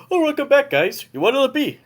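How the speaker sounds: put-on high Canadian bartender accent, second try; Canadian accent retry. Bartender welcoming guys back, Canadian accent. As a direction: lift the pitch and go Canadian again. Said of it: "a Canadian accent".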